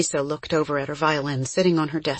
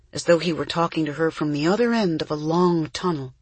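plain reading voice

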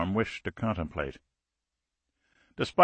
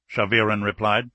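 usual speaking voice

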